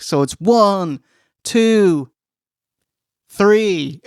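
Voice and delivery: one, two, then a held beat before three; long pause before three. Shown as a demonstration. Podcaster mimicking the referee's slow count, pause before three. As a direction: leave a gap before three. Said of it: "mild pause for the third count".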